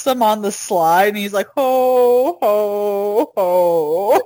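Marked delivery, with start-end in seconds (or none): 1.6-4.2 mock department-store belly-laugh syllables; three separate, drawn-out hos in a put-on voice; Santa. Imitating Santa pushing the kid down the slide, drawn-out ho-ho-ho. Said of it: "to tell Santa what he wants".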